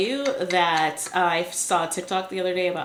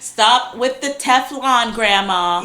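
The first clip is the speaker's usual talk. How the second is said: loud, high, slow scolding; sarcastic Gen Z; 4 semitones higher, 7 dB louder, slower than usual. Retold or imitated. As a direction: scold grandma with sass. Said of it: "they're also like".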